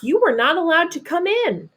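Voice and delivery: usual speaking voice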